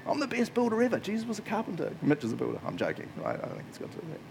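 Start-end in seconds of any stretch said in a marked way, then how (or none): 0.1-1.9 God chuckling at the question; incredulous God; amused God. Retold or imitated. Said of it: "God's like".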